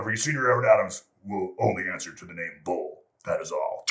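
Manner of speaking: mock-official proclamation, dropping at the sign-off; intercom announcement. Boss renames the airman over intercom, official announcement. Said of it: "turns off the intercom".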